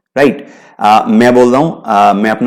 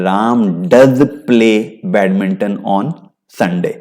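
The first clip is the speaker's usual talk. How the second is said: "does" firmly stressed, slow; 2 semitones lower, much slower than usual. Shown as a demonstration. Teacher countering blame about Ram. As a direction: lean on "does" in "Ram does play". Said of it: "अब मैं बोल रहा हूं कि खेलता ही है".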